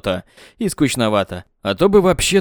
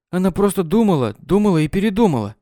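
Katya voice; 6 semitones higher than usual. A narrator's reading.

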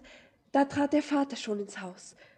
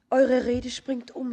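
alarmed outburst; 11 dB louder than usual. Acted. Alarmed girl confronts stranger.

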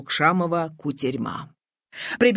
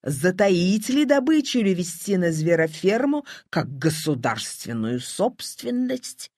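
usual speaking voice

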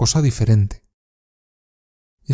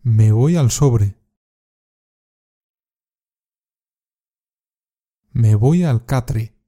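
slow drill phrase; slower than usual. Shown as a demonstration. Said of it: "Repite después de mí".

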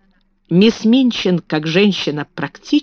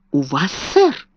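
astonished; 2 semitones lower than usual. A narrator's reading. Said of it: "с изумлением проговорила".

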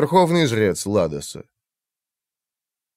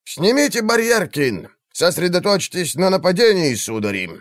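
commanding call; 7 semitones higher than usual. Acted. Magister commands in battle.

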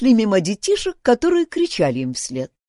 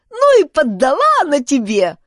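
put-on high, louder child's taunt; jeering child; 6 semitones higher, 6 dB louder than usual. A narrator's reading. Child teases boy who was knocked down, jeering. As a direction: crow gleefully over his defeat. Mocking onlooking child, voiced by narrator.

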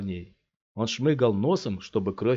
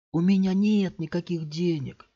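put-on higher, childlike protest; pleading kid; 9 semitones higher than usual. A narrator's reading. Scott pretends to be a boy, pleading with the thugs. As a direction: sound small and scared.

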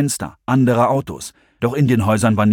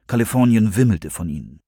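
ordinary delivery, nothing marked

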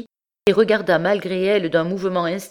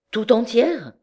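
incredulous, higher-pitched echo; astonished question; 6 semitones higher than usual. A narrator's reading. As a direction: ask it in disbelief, pitch swooping. Cousin questions her, taken aback.

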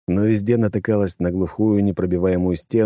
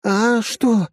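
dazed. A narrator's reading. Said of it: "ошалело закрутила она головой".

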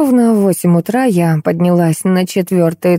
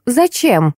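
higher, abrupt challenge; mildly barked demand; 6 semitones higher than usual. A narrator's reading. Unknown woman demands a reason, mildly barking. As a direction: throw the question out sharply. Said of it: "рявкнула женщина".

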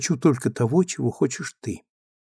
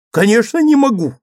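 much higher, louder exasperated cry; 10 semitones higher, 9 dB louder than usual. Acted. Husband answering his naive wife, exasperated and high. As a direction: cry it out, pitch soaring.

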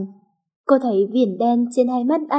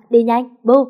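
louder shout at the dog; yelling; 5 dB louder than usual. Acted. Boy yelling for his dog to chase his mother out. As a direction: call the dog sharply to chase her off. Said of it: "Ken la làng lên".

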